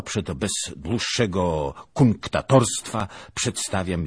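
loud, stately declaration of a proud knight; proud formal suitor. Acted. Lieutenant asks the princess for Helena, loud and proud. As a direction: announce the demand as a lordly command.

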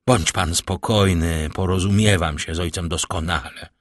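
usual speaking voice

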